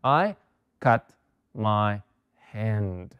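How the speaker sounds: I cut my finger spaced out with pauses between words; word-by-word dictation. Shown as a demonstration. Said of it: "dictation word by word".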